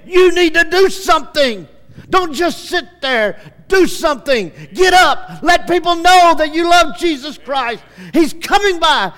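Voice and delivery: high, loud shouted urging; urgent command. Acted. God urging the hearer to stand up, loud urgent shouts. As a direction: push them to get up and act now.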